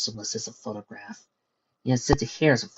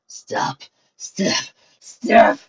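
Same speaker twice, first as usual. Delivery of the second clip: building scream; 11 semitones higher, 8 dB louder, much slower than usual. Acted. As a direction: whisper rising to a scream. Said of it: "the words broke free".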